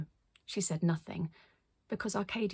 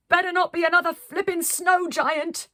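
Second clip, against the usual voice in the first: higher, louder complaining shriek; shrieking grumble; 10 semitones higher, 8 dB louder than usual. Acted. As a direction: squawk your complaint. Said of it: "shrieked Red Woolly Hat".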